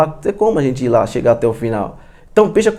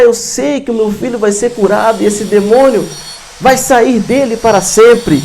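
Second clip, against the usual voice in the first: high-pitched, desperate begging; desperate father's plea; 7 semitones higher than usual. Acted. Father begging the Lord to heal his son, desperate pleading. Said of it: "Ele chega chorando, amados, porque está desesperado".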